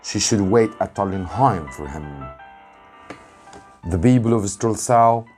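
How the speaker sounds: usual speaking voice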